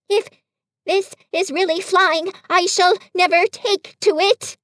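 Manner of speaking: a frightened little animal bouncing along; Piglet, nervous and jolted; timid Piglet. A narrator's reading. Piglet in the jolting pocket.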